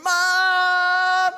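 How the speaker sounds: put-on high, loud child's call for mom; screaming for mom. Kid screaming for mom after the fight, high and loud. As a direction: yell for mom like a wronged kid. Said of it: "goes screaming".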